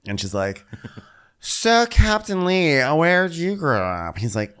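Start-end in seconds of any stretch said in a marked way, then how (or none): 1.4-4.2 put-on high, slow drunken slur; drunk Terry. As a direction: imitate a sloshed guest making conversation. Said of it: "now Terry is wasted".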